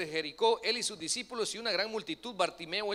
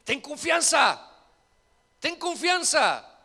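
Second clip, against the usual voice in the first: slow, loud, higher reassurance; loud encouragement; 7 semitones higher, 9 dB louder, slower than usual. Acted. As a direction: call it out slowly to the blind man. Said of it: "¿qué le dijeron?".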